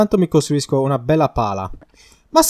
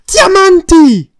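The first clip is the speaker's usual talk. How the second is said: high, loud, drawn-out yell; ecstatic scream; 13 dB louder, much slower than usual. Real, not acted. Player shows off diamond find to partner, ecstatic scream. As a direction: stretch the triumphant scream out slowly. Repeats the discovery.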